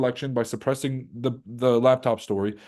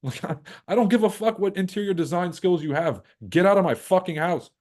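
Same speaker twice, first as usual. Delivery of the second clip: retold outburst; 7 semitones higher than usual. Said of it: "I'm like".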